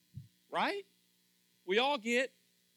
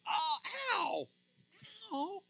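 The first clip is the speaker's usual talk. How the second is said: mock ow; 10 semitones higher, much slower than usual. Pruned branch feeling the cut.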